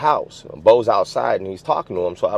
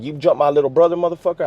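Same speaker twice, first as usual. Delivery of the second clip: higher-pitched mock confrontation; retold angry accusation; 8 semitones higher, faster than usual. Older guy confronting kid over little brother, retold angry accusation.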